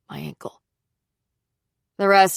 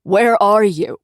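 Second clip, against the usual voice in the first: louder, urgent demand; frantic demand; 3 semitones higher, 8 dB louder, slower than usual. Acted. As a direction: bark the question in panic. Frantic friend on the phone.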